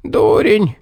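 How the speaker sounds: high, rasping dying gasp; a dying fox wheezing an insult; hoarse gasp. A narrator's reading. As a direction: croak it out with the last breath. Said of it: "прохрипела она".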